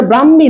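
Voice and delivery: ব্রাহ্মী as bram-mi, no h; wrong pronunciation. Shown as a demonstration. Teacher explaining the conjunct letter, ব্রাহ্মী mispronounced as bram-mi. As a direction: double the m in ব্রাহ্মী. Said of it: "এটা ব্রাহ্মী না".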